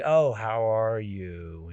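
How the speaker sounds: drawn-out, very slow mock sympathy; talking down. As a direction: imitate someone patronizing a sick person. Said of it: "as if they talk down".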